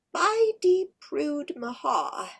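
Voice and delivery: high, slow fairy incantation; mumbled fairy spell. Shown as a demonstration. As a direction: murmur a fairy spell, slowly. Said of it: "She mumbled a phrase that sounded like".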